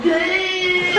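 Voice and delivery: high shout of the same words as the ride drops; mock terrified yell. Friend imagines shouting on the drop, high mock yell. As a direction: yell it like you're plunging down.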